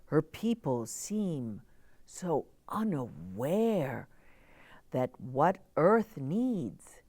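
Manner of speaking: gentle, rhythmic storybook reading; reading to children. A narrator's reading. Reading a picture book poem.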